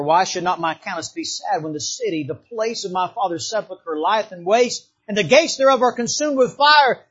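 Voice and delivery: usual speaking voice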